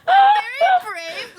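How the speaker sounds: hammed-up brag to a friend; mock proud. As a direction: puff yourself up as a joke.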